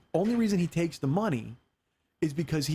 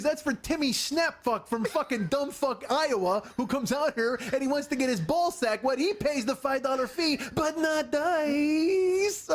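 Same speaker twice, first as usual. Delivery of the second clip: higher, slow, dissolving into laughter at end; laughing Dice rant; 8 semitones higher, slower than usual. Retold or imitated. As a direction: rant on while breaking up.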